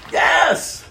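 ordinary talk, nothing marked

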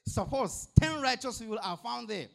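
loud, high last bargaining; Abraham making his final bargain with the Lord, loud and high; final plea. Acted.

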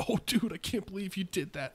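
a lightly mocking laughing-bro take.

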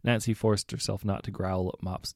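ordinary delivery, nothing marked

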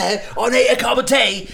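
loud, high mock London accent; squawked caricature English accent. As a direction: imitate an English tea drinker, hammed up. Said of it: "Hablan así".

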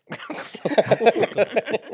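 high, loud laughing fit; loud helpless laugh; burst of laughter. Real, not acted. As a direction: crack up loudly, high-pitched. Amused while googling the answer.

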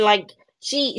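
ordinary talk, nothing marked

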